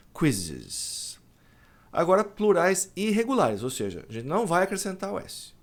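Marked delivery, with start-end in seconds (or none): 0.1-1.2 "quizzes" said low, English Z sound; English plural quizzes. Shown as a demonstration. Teacher models "quizzes" for students. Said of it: "Então, quiz".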